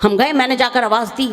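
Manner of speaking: ordinary talk, nothing marked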